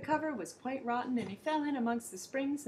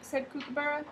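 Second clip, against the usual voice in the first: usual speaking voice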